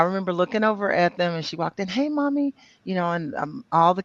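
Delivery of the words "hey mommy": high, sweet voice for the daughter; affectionate high-pitched hello; daughter's greeting. Retold or imitated. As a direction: lift the pitch to imitate a daughter.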